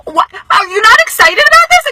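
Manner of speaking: wife squeals at her calm husband in disbelief; squealing disbelief. Retold or imitated. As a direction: shriek it at him, amazed he is calm. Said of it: "I'm like".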